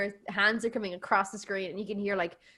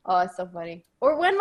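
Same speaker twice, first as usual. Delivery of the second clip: normal talking voice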